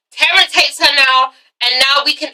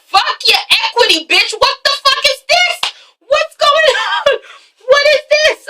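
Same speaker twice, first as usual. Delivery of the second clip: high, squeaky mock fury; pitched-up indignant rant; mock outraged man; 12 semitones higher than usual. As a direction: ham up his outrage with a half laugh. Man's furious retort, retold.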